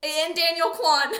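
loud, high mock cheer; mock excitement. Mock-excited add-on to all-male list.

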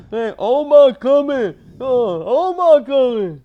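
high excited yell, laughing; shouted Wire quote. Pretend. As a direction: yell it like a street lookout.

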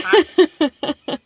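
ordinary talk

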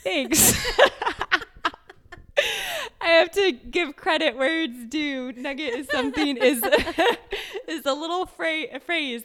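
bursting into real laughter; laughing. Real, not acted. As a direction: talk through giggles. Laughs after being praised.

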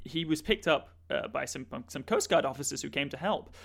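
normal talking voice

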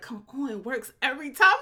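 mock pleading quote. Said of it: "Barry's like".

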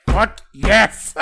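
loud, high-pitched shout of triumph; excited cheer. Retold or imitated. Fan reacting to Nami's defiant line, excited cheer. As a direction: whoop it out like a thrilled fan. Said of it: "And I'm just like".

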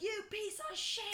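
high-pitched put-on British rant; mock screaming abuse. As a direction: hurl it as an insult, hammed-up British. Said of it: "He's kinda screaming at him".

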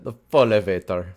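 "felevator" in a light Russian accent; mock Russian, rolled and heavy. Shown as a demonstration. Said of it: "You have to say it with a Russian accent".

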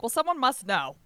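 mock exasperation; exasperated. Exasperated retort.

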